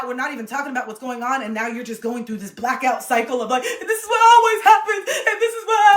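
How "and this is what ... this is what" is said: high, loud, laughing mock sob; tearful meltdown. Mocking a partner stuck in a tearful blackout cycle. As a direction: ham up the spiral through laughter.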